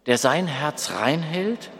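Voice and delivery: ordinary delivery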